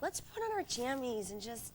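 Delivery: cozy coaxing. Retold or imitated. Girlfriend wanting a night at home, cozy coaxing. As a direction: coax softly and slowly. Retold plea to stay home.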